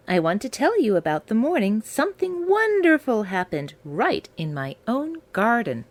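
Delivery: plain reading voice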